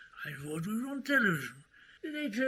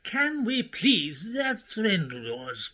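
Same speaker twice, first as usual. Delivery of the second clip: usual speaking voice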